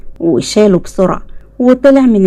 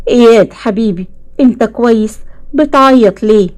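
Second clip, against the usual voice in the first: ordinary delivery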